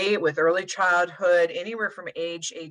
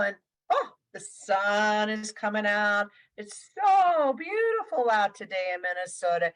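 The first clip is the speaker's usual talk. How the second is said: gentle demo voice, lifting higher on the breeze and rain; sing-song weather report; 3 semitones higher than usual. Shown as a demonstration. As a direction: croon the weather like a back rub for a child. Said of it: "I'm gonna have you guys kinda do this with me".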